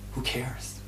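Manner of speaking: whispered shrug; whispered aside. Real, not acted. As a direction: breathe it out lightly. Whispered dismissal.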